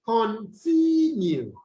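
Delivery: "continue" slowly, leaning long on "tin"; stress on second syllable. Shown as a demonstration. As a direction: draw out the middle of "continue" to show the stress. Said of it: "you have the stress on the second syllable".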